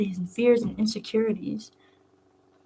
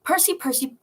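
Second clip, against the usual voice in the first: high, sneering "Percy, Percy" with falling tune; mock the name; mocking repetition; 7 semitones higher than usual. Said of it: "He was cut off".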